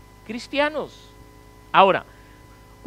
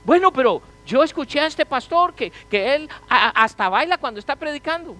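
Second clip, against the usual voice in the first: mock objector; 8 semitones higher, 4 dB louder than usual. Objecting churchgoer quoted.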